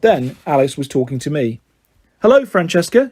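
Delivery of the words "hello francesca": reluctant higher-voiced greeting; grudging phone greeting; unenthusiastic. A narrator's reading. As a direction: say hello with little enthusiasm. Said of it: "she said unenthusiastically".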